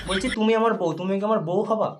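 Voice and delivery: normal talking voice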